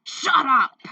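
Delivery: loud furious bark; angry shout. Acted. Villain silencing his captive, loud furious shout. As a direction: snap it at her full force.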